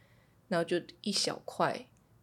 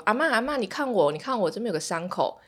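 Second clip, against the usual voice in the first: put-on excited child calling; 5 semitones higher, 6 dB louder than usual. Son calling out to grandma.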